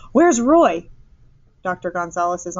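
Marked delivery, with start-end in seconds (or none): 0.1-0.9 higher, louder alarmed demand; gasped question. A narrator's reading. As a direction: ask it in sudden alarm, pitched up. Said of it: "Missus Eberhardt gasped".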